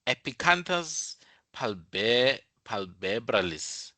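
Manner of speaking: slow syllable by syllable "Epicanthus palbe-, palpebralis."; slow, careful "Epicanthus palpebralis"; careful term. Shown as a demonstration. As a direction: sound out "palpebralis." slowly. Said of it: "this one is called".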